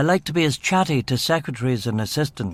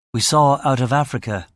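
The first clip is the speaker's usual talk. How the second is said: plain reading voice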